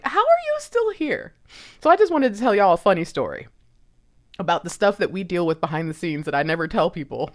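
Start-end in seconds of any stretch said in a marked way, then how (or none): 0.0-1.3 amazed. Retold or imitated. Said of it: "And I'm like".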